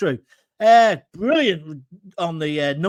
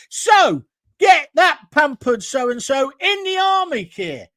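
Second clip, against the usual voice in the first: ranting shout; 5 semitones higher, 6 dB louder than usual. Real, not acted.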